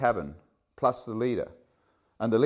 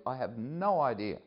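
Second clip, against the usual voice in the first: ordinary talk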